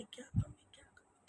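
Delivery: whispering to herself, stumped; soft unvoiced wondering; puzzled whisper. Real, not acted. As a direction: breathe क्या कमी twice under your breath. Puzzling alone over failed dish.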